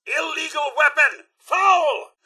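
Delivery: high, indignant call-out; calling a foul. Acted. Butler calling out an illegal weapon, high outraged cry.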